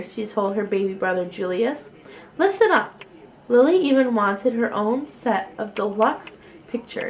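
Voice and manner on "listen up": high, loud mock-teacher order; bossy little teacher. Lily playing teacher to baby brother, high and bossy. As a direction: play the teacher, high and commanding.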